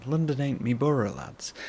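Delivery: put-on a light northern English accent, dropping into local slang; mock a northern English lad lightly. Telling viewers how folk up north talk, in a light northern English accent. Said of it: "That's how they sound".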